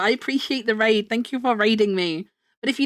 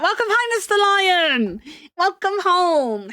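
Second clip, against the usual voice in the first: welcoming someone home; 8 semitones higher, slower than usual. Real, not acted. Greeting returning viewer.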